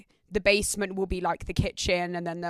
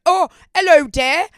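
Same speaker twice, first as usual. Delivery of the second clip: much higher and louder fake posh British accent; mock British accent; 8 semitones higher, 13 dB louder than usual. Americans mocking her British accent, in a shrill over-the-top British accent. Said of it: "they'd all take the piss out my accent".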